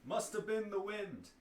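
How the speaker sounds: high, put-on dismissive voice; oblivious guard. Guard dismissing a strange noise, high put-on voice. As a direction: lilt it high, brushing off the noise.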